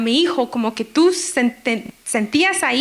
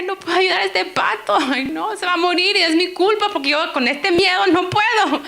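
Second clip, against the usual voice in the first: higher-pitched distressed plea, lightly acted; mock weepy self-blame, pitched up; retold tearful lament; 6 semitones higher than usual. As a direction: retell a tearful, helpless outburst. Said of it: "me puse a llorar porque dije".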